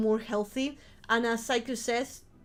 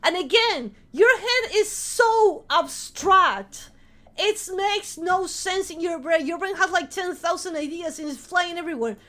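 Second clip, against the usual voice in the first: worked-up, higher and louder; insistent, raised-voice urging; emphatic rant; 5 semitones higher, 4 dB louder than usual. Real, not acted. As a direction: hammer the point home forcefully. Heated rant urging viewers.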